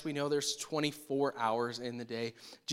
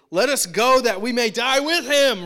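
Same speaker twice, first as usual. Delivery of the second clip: high, much louder rallying cry; all-in eagerness; 8 semitones higher, 12 dB louder than usual. Retold or imitated. Thomas urging disciples to follow Jesus, loud eager rallying cry. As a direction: fire everyone up to go die with him. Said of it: "Thomas is all in here".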